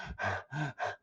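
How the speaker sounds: soft breathy puffs; feigned panting. Acted. Nurse showing Juliet she is winded, mock panting. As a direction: pretend to be out of breath. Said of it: "I'm out of breath".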